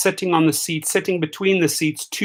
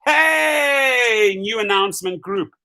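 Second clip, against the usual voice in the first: shouting out the announcement like a herald; a hammed-up corporate announcer; mock company announcement; 7 semitones higher, slower than usual. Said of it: "Here they are".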